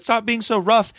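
high-pitched imitation of a scolding mom; retold motherly protest in a high voice.

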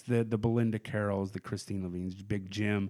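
ordinary talk, nothing marked

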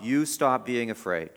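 "You" hit first and firmest, rest falling; gentle command with "You" stressed. Shown as a demonstration. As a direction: put the weight on "You", not on "afraid". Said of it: "The emphasis in the Greek text is on you".

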